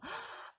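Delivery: sudden breathless gasp; boy spotting his lost doll; sharp gasp. Acted. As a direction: gasp in delighted shock. Harry sees his doll.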